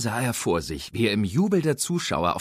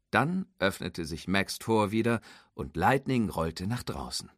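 usual speaking voice

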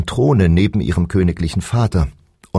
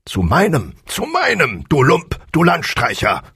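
roaring tyrant king; 11 semitones higher than usual. A narrator's reading. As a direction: splutter with royal fury. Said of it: "lärmte der König". (brüllender tyrannischer König)

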